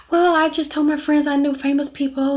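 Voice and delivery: high-pitched imitated whining; mock whining excuse. Mother's tearful excuse, acted out.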